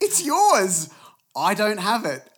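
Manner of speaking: high, loud frustrated protest; exasperated retort. Retold or imitated. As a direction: snap back at dad, fed up. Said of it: "I'm like".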